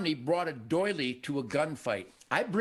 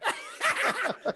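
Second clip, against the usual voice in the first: high-pitched cackling laugh. Real, not acted. Hunter laughing at his critics, high laugh. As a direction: crack up at your own joke. Cracks up at own retort.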